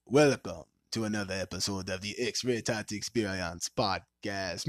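ordinary talk

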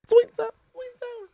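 squeaky high drive-through worker; high put-on voice.

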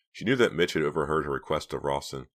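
usual speaking voice

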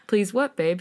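usual speaking voice

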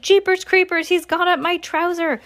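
high-pitched yelp of alarm; startled shout. A narrator's reading. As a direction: cry out high in comic panic. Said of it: "the cook shouted".

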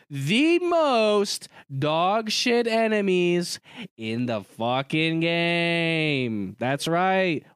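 slow, drawn-out emphatic rant; Donald Trump. Real, not acted. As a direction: stretch every word like a rally speaker. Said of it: "Why are you doing Donald Trump?".

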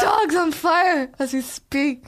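loud, high, drawn-out fake panic; hammed-up wail of comic disaster; slow, exaggerated mock despair; mock hysterics. Disaster call continues after a remark.

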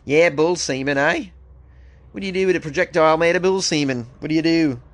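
hammed-up Aussie bloke that slips into Liverpool; Aussie firefighter joking about bull semen, accent sliding to Scouse; Australian accent gone Liverpool. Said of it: "it sounded like one of the Beatles".

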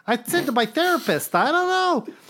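Woody Allen. Retold or imitated. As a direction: fret like a nervous New Yorker, pitch jumping up. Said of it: "that sounds more Woody Allen".

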